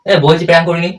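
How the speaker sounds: loud protest that it's not a prank; loud insisting. Real, not acted. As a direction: say it loud to be believed.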